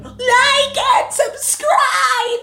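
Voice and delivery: put-on high, slow, drawn-out kid shout; nasally kid yelling. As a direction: scream it like a hyped nine-year-old. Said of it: "nine-year-old nasally voice".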